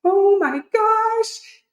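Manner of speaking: high, slow, drawn-out awe; stunned delight at discovering physique shaping; amazed gasp. Retold or imitated. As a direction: let the discovery hit you slowly. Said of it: "I'm just like".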